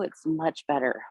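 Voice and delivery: normal talking voice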